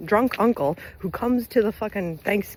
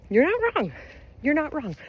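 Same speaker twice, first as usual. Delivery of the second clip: validate them in a raised, light voice; pitched-up reassurance to worried listeners; high-pitched validation; 10 semitones higher than usual. Retold or imitated.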